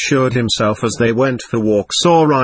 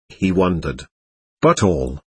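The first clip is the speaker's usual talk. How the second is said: usual speaking voice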